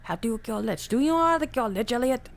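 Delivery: ordinary talk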